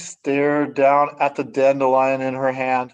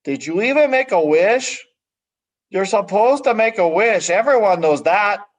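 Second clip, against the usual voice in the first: put-on bossy older brother butting in; 6 semitones higher, 3 dB louder than usual. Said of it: "her brother butted in".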